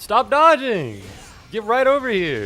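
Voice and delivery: high, loud yell at the enemy; frustrated shout. Real, not acted.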